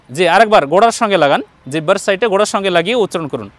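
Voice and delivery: ordinary talk, nothing marked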